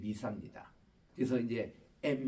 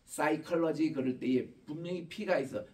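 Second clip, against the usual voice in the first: ordinary talk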